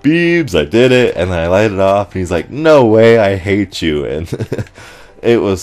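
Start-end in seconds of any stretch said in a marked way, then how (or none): 0.0-1.2 high, loud call to a friend; retold triumphant shout. Player telling his friend he won, triumphant shout. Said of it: "and I shout". 2.5-4.0 loud retold disbelief, easing off; mock outraged exclamation. Friend reacting to the player's win, mock outrage.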